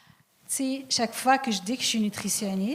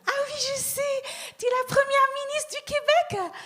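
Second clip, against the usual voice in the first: very high-pitched put-on lady's voice; hammed-up, high-pitched know-it-all lady; excited guessing lady. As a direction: squeal the guess as an excited holidaymaker. Acting a lady guessing her job.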